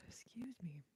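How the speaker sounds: ordinary talk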